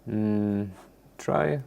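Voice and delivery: ordinary talk, nothing marked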